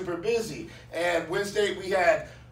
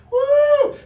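high, loud whoop; excited whoop; 11 dB louder than usual. Real, not acted. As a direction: let out a joyful woo. Excited shout mid story.

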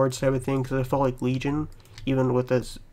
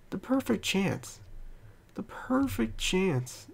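slow, higher mock lament; mocking drawn-out scolding; 7 semitones higher, 6 dB quieter, slower than usual. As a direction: stretch it out, rubbing it in.

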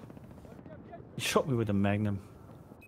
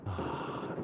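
sigh; 6 semitones lower, 12 dB quieter than usual. Real, not acted. Frustrated after being killed.

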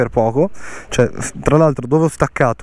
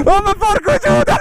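high yelled oath; explosive italian cursing. Real, not acted. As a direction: curse out in disbelief. Downhill race, shouting curses.

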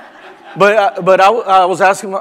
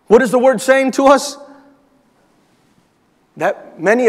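preaching at full force; 4 semitones higher than usual. Acted. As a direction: press the point home to the congregation. Preaching continues after the verse.

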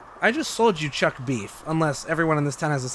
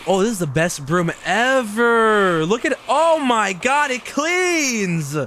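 high, slow, gushing delight over a broom; mock thrilled; 7 semitones higher, 4 dB louder, slower than usual. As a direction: rave about the broom like it's a treasure.

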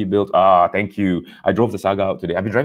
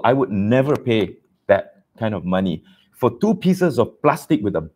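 ordinary talk, nothing marked

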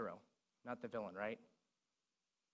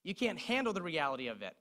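Jack Nicholson shout; 4 semitones higher, 5 dB louder than usual. Retold or imitated. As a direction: bellow it like the colonel on the stand. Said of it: "And Jack Nicholson says".

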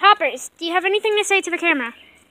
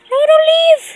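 ordinary talk, nothing marked

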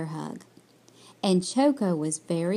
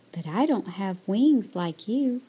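usual speaking voice

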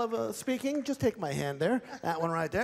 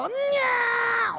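puppet lady voice; 14 semitones higher, 5 dB louder than usual. Acted. Ventriloquist voices volunteer lady puppet.

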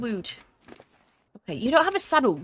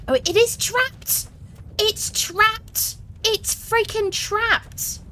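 much higher, squealing that it's trapped; excited disbelief; 13 semitones higher, much slower than usual. Real, not acted. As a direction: shriek it out in disbelief. Finds creature trapped.

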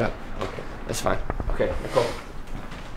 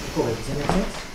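ordinary talk

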